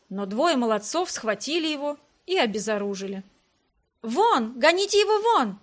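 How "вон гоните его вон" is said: high, loud cries to drive someone out; raised, high-pitched group outcry; women shouting. A narrator's reading. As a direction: cry out like outraged women. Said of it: "кричали женщины".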